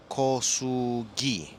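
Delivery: "Kosugi" sounded out very slowly, syllable by syllable; struggling pronunciation. Shown as a demonstration. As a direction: stretch "Kosugi" into separate pieces, hard G at the end. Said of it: "I'm struggling over here".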